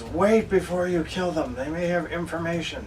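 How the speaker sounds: loud interjection, easing off toward the end; urgent in-character plea. Acted. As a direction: call out to hold off the attack. Repeats the yelled plea to companions.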